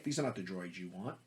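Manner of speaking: lightly voiced calm Jedi; a hint of a hypnotic Obi-Wan; softly imitating a Jedi; Jedi mind trick. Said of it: "give them the Jedi mind tricks".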